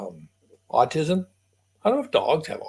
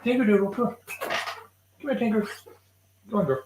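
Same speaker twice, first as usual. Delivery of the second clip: higher calling voice for the dog; high coaxing call; 8 semitones higher, 4 dB quieter, much faster than usual. Real, not acted. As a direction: call the dog over, pitched up.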